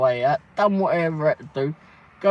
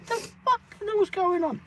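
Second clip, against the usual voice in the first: high, crying-edged disbelief; baffled. Retold or imitated. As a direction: wail it out, high and mock distressed. Repeated bafflement.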